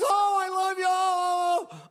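high, loud sobbing cries of joy; joyful crying shout. Acted. Mary clinging to Jesus for dear life, high tearful shouts of joy. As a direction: cry out with love while clinging on.

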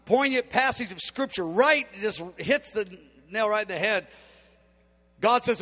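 ordinary talk, nothing marked

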